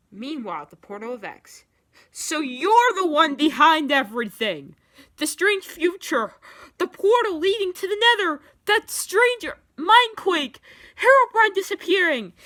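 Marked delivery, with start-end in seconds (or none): 2.1-12.3 high, loud outraged accusations; accusing shout. A narrator's reading. Steve confronts X at the portal, shouting. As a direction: hurl each item as an accusation.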